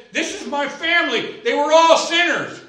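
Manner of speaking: joyful, proud shout about his family; laughing, excited retold friend. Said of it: "he said it with excitement in his voice".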